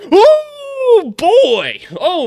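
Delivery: high, loud whooping cry; excited shout. Real, not acted. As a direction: let the dunk lift you out of your seat. Reacting to a big dunk.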